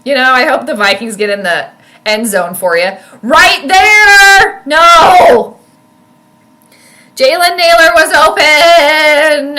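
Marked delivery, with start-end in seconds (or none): 3.2-9.6 high, loud cry over the missed throw; slow pained protest at the end; anguished shout. Real, not acted. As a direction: yell at the screen in despair. Missed open receiver reaction.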